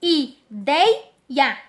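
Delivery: IDEIA broken as "i... dei... a"; split into syllables. Shown as a demonstration. Teacher showing vowels standing alone, syllable by syllable. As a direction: pause between the three parts of ideia. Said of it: "elas podem formar sílabas sozinhas".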